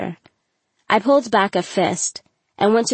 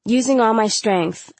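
plain reading voice

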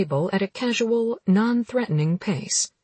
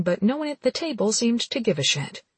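ordinary delivery

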